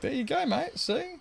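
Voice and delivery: very high, cheeky know-it-all voice; high mock-smug "there you go, mate"; smug reply. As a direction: rub it in playfully. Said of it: "And I'm like".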